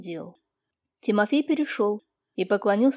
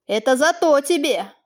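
higher, louder, voice a little unsteady; trembling voice; 3 semitones higher, 4 dB louder than usual. Acted. Master scolds the young peasant, voice trembling. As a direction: shaken master scolds the boy. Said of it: "Голос его не переставал дрожать".

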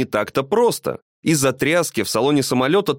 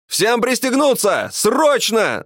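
higher-pitched urgent cry; pilot's shout; 9 semitones higher, slower than usual. A narrator's reading. Pilot ordering passengers to buckle up, urgent high-pitched shout. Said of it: "закричал пилот".

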